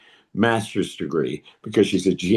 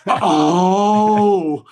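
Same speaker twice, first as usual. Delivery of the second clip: long, loud, higher "oh"; hammed-up wail of sudden understanding; horrified realization; 8 semitones higher, 6 dB louder than usual. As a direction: stretch it out as the full horror lands.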